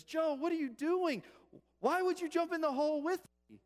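high, loud disbelief; acted shout of exasperated astonishment; incredulous yelling. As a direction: protest at a friend's baffling act. Trapped man, incredulous at friend.

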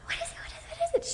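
high, hushed, urgent questions; eager whisper. Shown as a demonstration. Friend mirrors excitement, high urgent whisper. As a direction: whisper it, dying to know. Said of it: "she's like".

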